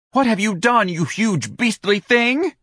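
very high, loud cry, slowing into beastly thing; outraged cry. Acted. Dorian crying out at Ignatius, high outraged cry. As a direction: shriek at him in prissy outrage. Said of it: "Dorian cried".

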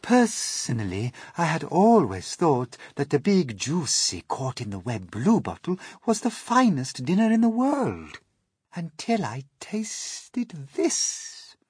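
high, loud, savoured delivery; rapturous Miss Spider. Acted. Spider joining the feast, rapturous praise. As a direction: build high, then draw out the ending.

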